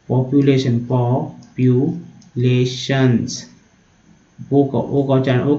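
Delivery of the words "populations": "populations" very slow, in separate syllables; broken-down pronunciation. Shown as a demonstration. Teacher sounding it out for learners, very slow split "populations". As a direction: break "populations" into po-pyu-lay-shuns with long gaps. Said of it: "ऐसे तोड़ के पढ़ना है".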